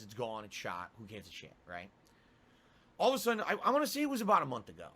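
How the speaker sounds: usual speaking voice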